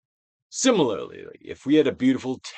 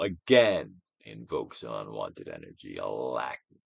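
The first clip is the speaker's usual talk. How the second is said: guided meditation; 4 dB louder than usual. Acted. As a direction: coax the listener to let feelings pass.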